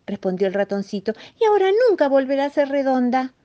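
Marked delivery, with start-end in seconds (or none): 1.4-3.3 high, louder mouse lament; anguished lament. Acted. Mouse tells the rabbit the moon is spoiled, anguished lament. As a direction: lament it in a small distressed voice. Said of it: "bastante angustiado".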